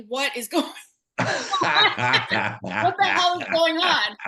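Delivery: mock exasperated, slowed by laughter; a hint of outrage breaking into laughter; laughing through it. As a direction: laugh while quoting the shocked husband. Husband walks into chaos.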